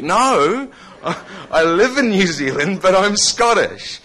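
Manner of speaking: high, loud, laughing protest; exasperated insisting. Retold or imitated. Correcting a stranger who calls him a Kiwi, laughing insistence.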